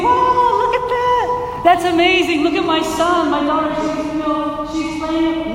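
a parent cheering a baby about to swallow something sharp, mock thrilled; gushing mock praise. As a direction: gush like a clueless proud parent. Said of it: "You're gonna say".

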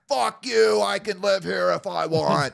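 high, loud hollered imitation of the homeless guy; mock scream back. Homeless guy defying the yelling resident, screamed back. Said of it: "screaming back".

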